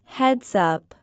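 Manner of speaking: normal talking voice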